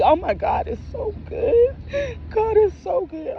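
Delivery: very high, squeaky relieved cry; re-enacted tearful joy. Mother getting her daughter back, re-enacted tearful joy.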